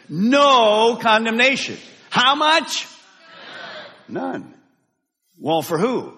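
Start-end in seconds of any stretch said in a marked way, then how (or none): 0.1-1.8 slow, emphatic no condemnation; no condemnation said as a slow chant; leading the congregation. Shown as a demonstration. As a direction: lean on No, leading the room. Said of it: "Say it with me".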